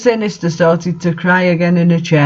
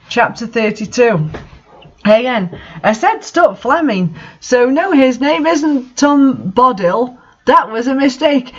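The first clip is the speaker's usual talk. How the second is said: ordinary delivery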